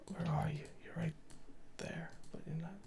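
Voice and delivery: soft whisper to himself. Real, not acted. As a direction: whisper it under your breath. Puzzling to himself at screen.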